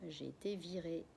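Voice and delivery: small, soft confession; tiny voice. Acted. Father admitting to the family he was fired, tiny soft voice. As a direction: barely get the words out. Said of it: "d'une toute petite voix".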